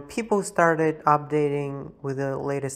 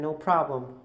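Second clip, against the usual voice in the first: ordinary delivery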